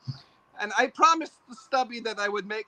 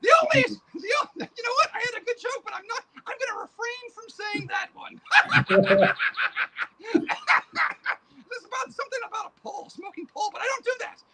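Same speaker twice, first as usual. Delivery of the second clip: a high cackling boogeyman; boogeyman puppet voice; 13 semitones higher, 5 dB louder, faster than usual. Acted. Puppet boogeyman greets the interviewer in a high creepy voice. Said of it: "Hey, boogeyman, come over here".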